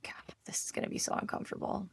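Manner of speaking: quick, hushed thought to oneself; dreading inner voice. Retold or imitated. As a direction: mutter it under your breath, dreading it. Retold inner dread before walking in.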